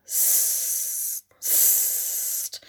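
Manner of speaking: voiceless hissed swoosh of the swing; swishing sound. Acted. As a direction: hiss it like air rushing past. Swinging higher on the hammock. (stimmloses Zischen wie ein Schwungrauschen)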